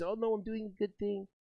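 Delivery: soft, mock-innocent kid voice; mocking naive kids. Streamer mocking kids who think they help, soft mock-innocent voice. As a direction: lightly voice a smug naive kid.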